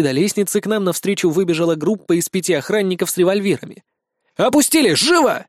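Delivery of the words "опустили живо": high-pitched furious command; fierce, urgent order; raging. A narrator's reading. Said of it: "свирепствовал я".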